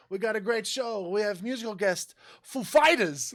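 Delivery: Walken-style intro punching [name]; Christopher Walken impression. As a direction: announce the band in Walken's odd rhythm. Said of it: "totally does it as Christopher Walken".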